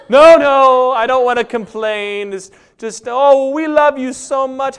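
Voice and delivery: high, loud, drawn-out fake modesty; hammed-up martyr. Poster brushing off concern in a high, hammed-up voice. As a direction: play the martyr to the hilt. Voicing the attention seeker.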